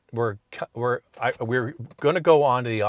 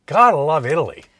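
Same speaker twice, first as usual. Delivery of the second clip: louder, beaming exclamation; delighted; 4 semitones higher, 10 dB louder than usual. Real, not acted. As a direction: relish the lucky escape. Joyful outburst after escaping fine.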